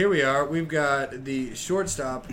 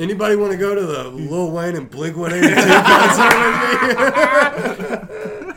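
mock teen voice; 6 semitones higher, 9 dB louder than usual.

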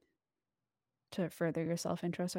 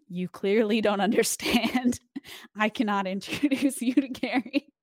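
louder, laughing through the words; laughing disbelief; 4 semitones higher, 7 dB louder than usual. Retold or imitated. Retelling a reply to a fan asking to meet Gary, laughing. Exasperated reply to favor request.

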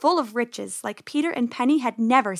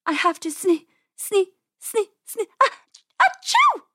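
high, halting build to loud sneeze; 10 semitones higher, 5 dB louder than usual. A narrator's reading. Penny hiding in the watering can, acted sneeze building. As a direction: stammer upward, then burst into a sneeze. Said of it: "Penny sneezed so hard".

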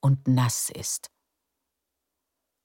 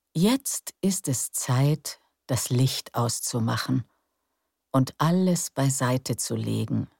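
guided meditation. Acted. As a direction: lull them into calm breathing. Leads breathing relaxation. (ruhige Meditationsstimme)

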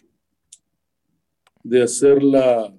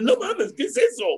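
much higher, squeaky incredulous quote; mock disbelief; 5 dB quieter than usual. Guest imitates people hearing he'll be a politician, high mock shock. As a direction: act out being stunned by the news.

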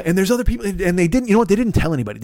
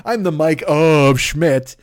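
mock grand title; 3 dB louder, much slower than usual.

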